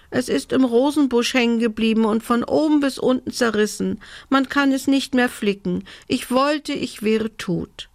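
mildly tearful, high elf voice; high lament, slowing at the end. A narrator's reading. Elf tells Lena about her torn dress, mildly tearful. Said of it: "Sie begann wieder zu weinen".

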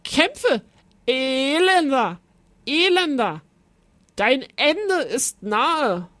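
high, hammed-up battle cry; knight's shrieked, theatrical doom-saying; screamed challenge. A narrator's reading. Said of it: "schrie der edle Ritter".

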